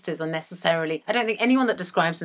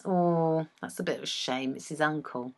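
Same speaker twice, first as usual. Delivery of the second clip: soft, low, mock-sorry murmur; quiet, lowered voice of mild pity; half-hearted regret; 6 semitones lower, 10 dB quieter than usual. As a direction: let a little sympathy sag out, low and quiet. Voices her sympathetic inner self.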